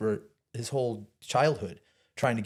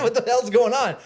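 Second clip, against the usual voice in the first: high, loud, laughing disbelief; stunned, half-laughing yell; shocked disbelief; 12 semitones higher, 10 dB louder than usual. Retold or imitated. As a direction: freak out that he overheard you. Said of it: "It's like, what".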